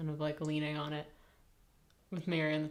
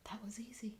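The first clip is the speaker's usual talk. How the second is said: gentle whisper; 5 semitones higher, 10 dB quieter, faster than usual. Acted. Tyrio telling Mary the fight was easy, soft whisper. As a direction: hush it like a secret. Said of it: "sends just this gentle".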